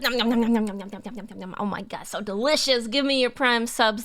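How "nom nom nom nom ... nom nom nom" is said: snapping teeth. Pretend. Eating prime sub soul bit.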